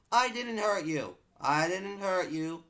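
high shouted protest; loud protest. Real, not acted. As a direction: yell it indignantly. Protests to attacking mob.

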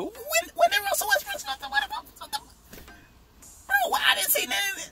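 extremely high squeaky falsetto; incredulous. Real, not acted. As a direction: squeak it out, unable to believe it. Cannot believe he missed it.

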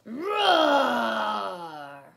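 long, loud roar falling in pitch; boy's roar. A narrator's reading. Boy roaring at Theodore, long loud playful roar. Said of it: "The boy roared at Theodore".

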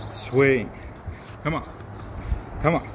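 usual speaking voice

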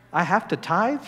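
mock reluctance about "have to"; reluctant question. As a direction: ask it like an unwelcome duty. Acted reluctant question, then corrected.

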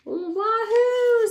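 very high, loud excited squeal; excited crayon. Acted. Green crayon jumping out of the box, squealing. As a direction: bounce out full of glee. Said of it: "said the green crayon".

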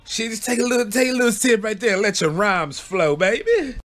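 high, sing-song coaxing voice; coaxing Flip. Retold or imitated. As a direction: tempt him to take a sip. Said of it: "He's like".

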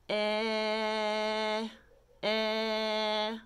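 "Eh" drawn out on one even note; held vowel. Shown as a demonstration. Teacher demonstrating letter E to learners, "eh" held long. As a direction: sustain each "eh" steadily on the breath. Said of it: "Let's do E".

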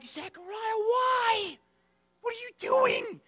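very high, drawn-out pleading; exasperated wail. Acted. Reader pleading with doubting Zechariah, high exasperated wail.

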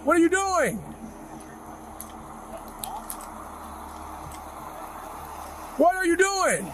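what are you doing, angrily; angry demand. Real, not acted. Officer confronting reckless driver.

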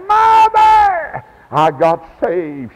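high, loud cry down the phone; excited shout. Retold or imitated. Son phoning mother that he got saved, excited shout. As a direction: call out the joyful news to mother.

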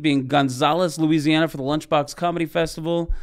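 ordinary delivery

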